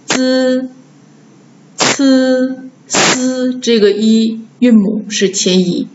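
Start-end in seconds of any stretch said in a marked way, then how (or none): 0.1-3.6 滋, 呲, 丝 held on buzzing front i; front-i demo. Shown as a demonstration. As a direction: sound out 滋, 呲, 丝 level and separate. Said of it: "前一是".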